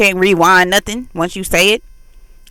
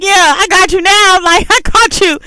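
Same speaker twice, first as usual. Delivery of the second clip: laughing gotcha; 12 semitones higher, 4 dB louder than usual. Acted. Retelling the gloating partner.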